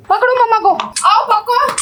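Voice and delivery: high, sing-song come-catch-me calls; playful calling. Real, not acted. Chase game, calling the kids.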